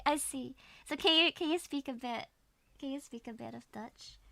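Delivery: normal talking voice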